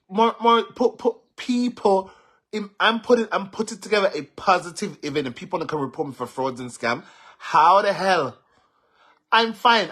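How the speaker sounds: high, loud and furious, voice straining; angry yelling. Real, not acted. Angry at Morris on the phone, yelling high and loud. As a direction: shout him down, fed up and hurt.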